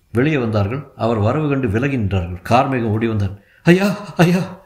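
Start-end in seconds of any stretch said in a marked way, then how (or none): 3.6-4.6 high, loud desperate call; pleading, upset shout; tearful cry. Acted. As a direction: cry out for the master, near tears. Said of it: "என்று கத்தினான். குரலில் அழுகை இருந்தது".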